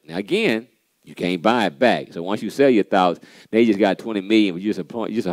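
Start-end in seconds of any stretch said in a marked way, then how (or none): none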